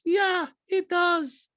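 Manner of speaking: very high, dragged-out mock retort; Diana. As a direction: answer back in a slow falsetto. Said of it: "Diana goes".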